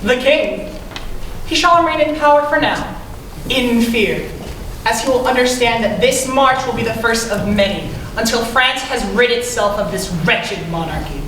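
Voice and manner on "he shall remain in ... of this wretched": defiant declaration. Acted. Rebel argues for king's fearful reign.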